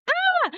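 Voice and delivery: sudden very high, loud yelp of horror; anguished shriek. Retold or imitated. Listening back to a badly mixed gig recording, a shriek. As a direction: let out a short shriek of despair. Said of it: "you listen back and you're like".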